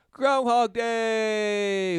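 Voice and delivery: loud slow mocking drawl; exasperated drawl. Real, not acted. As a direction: stretch it out in exasperation. Exasperated drawn-out remark.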